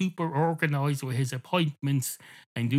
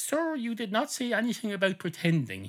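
indignant cat; 9 semitones higher than usual. Acted. Cat objects to the barman.

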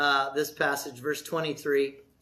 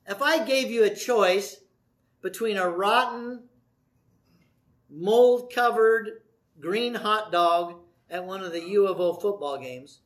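ordinary talk, nothing marked

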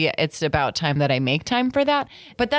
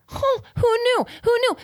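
squeaky who knew; high mock surprise; faster than usual. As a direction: fake astonishment up high. Mock surprise at trigger point.

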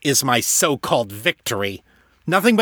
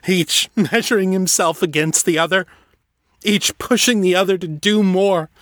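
tearful, pitched higher, breaking; grieving rival, tearful and higher; voice thick with tears; 10 semitones higher than usual. Acted. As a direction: mourn him through tears. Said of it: "his voice thick with tears".

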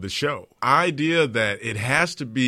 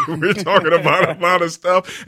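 laughing; 6 semitones higher, 6 dB louder than usual. Real, not acted. Still laughing after the laugh.

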